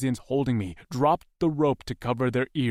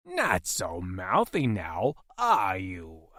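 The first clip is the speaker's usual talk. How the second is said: slow, gloating creature voice; menacing alien, unhurried; taunting alien captor; 3 semitones lower, slower than usual. A narrator's reading. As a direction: draw the taunt out slowly. Alien captor taunts wounded prisoner.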